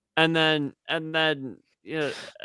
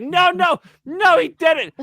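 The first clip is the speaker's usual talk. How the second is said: much higher, louder protest; imitated wife's shout; 13 semitones higher, 7 dB louder, slower than usual. Wife shouting down her husband's bait, imitated high shout. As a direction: shriek the denial as the shocked wife.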